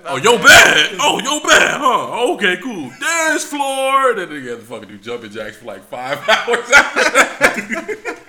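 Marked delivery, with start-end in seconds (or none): 0.0-4.1 loud, high mocking instructor; imitated sarcastic outrage. Instructor punishes the recruit after his apology, imitated sarcastic shouting. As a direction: throw the apology back loudly, then shout the order.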